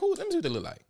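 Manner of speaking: high, playful curious squeal; eager ooh. Retold or imitated. Girlfriend asking boyfriend to show his friends, high and eager. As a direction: pitch it up like you can't wait to see.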